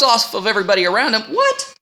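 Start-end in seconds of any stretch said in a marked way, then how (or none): 1.2-1.7 mock shock. Crowd's shocked reaction.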